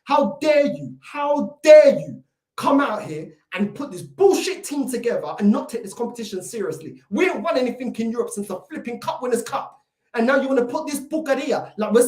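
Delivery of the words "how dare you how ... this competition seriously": real anger, surging loud on the key words; outraged. Real, not acted. Fan berating his manager over the team, outraged.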